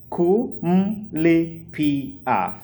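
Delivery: "kumlipia" drawn out slowly, each of ku-mli-pi-a spoken apart; syllable by syllable. Shown as a demonstration. Swahili teacher showing students how to say it, slow and syllable by syllable. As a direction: spell "kumlipia" out slowly in sounds. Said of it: "five syllables".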